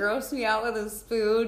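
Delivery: put-on bubbly, high Valley Girl talk. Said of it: "Did you talk like a Valley Girl?".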